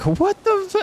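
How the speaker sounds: very high mock shriek at normal volume; squeaky retold outburst, cut off short; retold scream. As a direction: imitate your own startled scream, pitch shot right up. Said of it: "I was, like, screaming".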